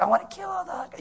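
high falsetto threat, hammed-up goddess; mock goddess. Pastor mocking fickle Greek goddesses, in a falsetto threat. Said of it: "the next day it's like".

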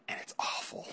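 breathy whispered aside. Real, not acted. To the youth group about bad singers, a whispered aside. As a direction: drop to a whisper to share the joke.

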